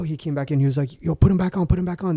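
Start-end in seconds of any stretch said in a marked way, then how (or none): 1.0-2.2 higher-pitched retold urgent order; urgent coach. Coach tells fighter to put the weights back on, urgent. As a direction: imitate a coach hurrying his fighter.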